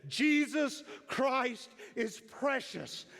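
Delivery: high, loud and very slow proclamation; thunderous declaration. Retold or imitated. Old preacher declaring to the sanctuary, thunderous. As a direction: thunder it out with all the strength left. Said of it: "it sounded like thunder".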